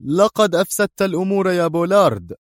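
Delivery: high, severe pronouncement; stern, mechanical. A narrator's reading. Boss tells his subordinate he ruined things, sternly. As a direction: deliver the blame with unbending strictness. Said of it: "أجابه الصوت الصارم الآلي".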